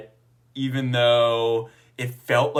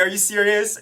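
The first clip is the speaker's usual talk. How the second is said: higher, amazed disbelief; surprised; 9 semitones higher, 3 dB louder than usual. Retold or imitated. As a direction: imitate the friend's stunned question. Friend stunned by coming out.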